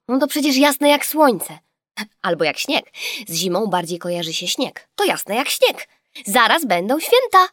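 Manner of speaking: high, loud lively explanation; lively girl. A narrator's reading. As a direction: explain it as obvious, excited. (żywo i głośno)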